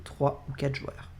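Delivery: ordinary delivery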